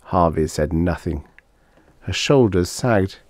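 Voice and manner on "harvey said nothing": ordinary delivery, nothing marked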